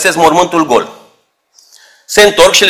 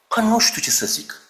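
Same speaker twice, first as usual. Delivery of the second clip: a hint of a hesitant disciple, much softer and higher; unsure disciple; 5 semitones higher, 10 dB quieter than usual. Doubting disciple answers his friend on the road, softly unsure. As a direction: mumble it, lost for an answer.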